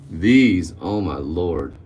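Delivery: ordinary delivery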